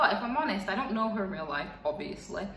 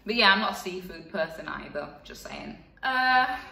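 normal talking voice

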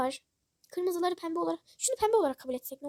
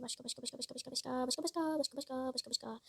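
softer repeated muttering under breath; quiet searching murmur; 8 dB quieter than usual. Real, not acted. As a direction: murmur to yourself while looking. (kendi kendine alçak sesle mırıldanma)

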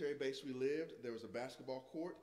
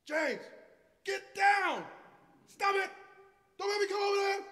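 high, loud shouting across the court; yelling at brother; 14 dB louder than usual. Retold or imitated. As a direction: yell over like a big brother. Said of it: "I would go".

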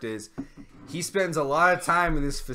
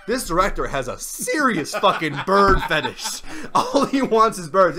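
louder, laughing through words; 4 semitones higher, 7 dB louder than usual. Real, not acted. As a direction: crack up while saying it. Laughing at bird fetish.

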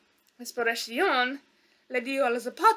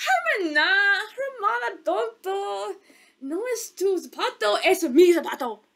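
high, whining singsong sister; whiny younger stepsister; 5 semitones higher, 4 dB louder than usual. Acted. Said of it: "La hermana menor dijo".

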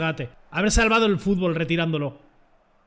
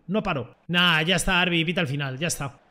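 ordinary talk

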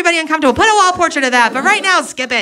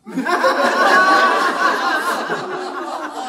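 laugh; 5 semitones higher, 3 dB quieter than usual. Real, not acted. Laugh at customer.

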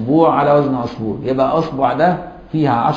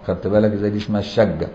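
ordinary talk, nothing marked

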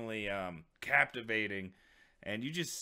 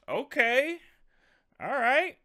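much higher, louder surprised okay; real surprise; 13 semitones higher, 7 dB louder than usual. Real, not acted. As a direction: caught off guard, pitch jumps up. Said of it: "Was not expecting that at all".

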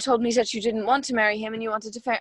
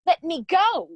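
raised, shouting to be let go; pulling away; 6 semitones higher, 5 dB louder than usual. Acted. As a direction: yank free and shout it. Grabbed by the wrist.

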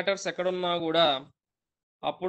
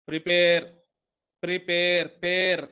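Prepare said with pair punched louder; stress on pair; 5 dB louder than usual. Shown as a demonstration. English teacher to students, stressing pair firmly. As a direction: lean on the second syllable pair. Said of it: "పేర్ దగ్గర P start అవుతుంది".

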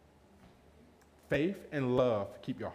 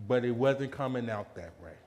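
ordinary delivery

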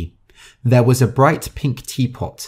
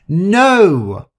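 sharp no; 8 semitones higher, 6 dB louder than usual. A narrator's reading. Rosa snaps at the tenants.

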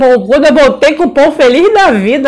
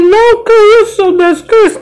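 much higher mock-shocked protest from the uncle; the uncle squealing in alarmed refusal; 11 semitones higher than usual. Uncle refuses to drop pants. (protesto chocado, bem agudo)

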